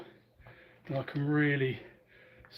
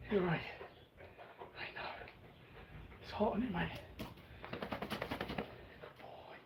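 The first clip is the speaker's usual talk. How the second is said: higher, softer sympathetic pet talk; talking to a dog; 4 semitones higher, 12 dB quieter than usual. Real, not acted. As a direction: fuss over the dog kindly.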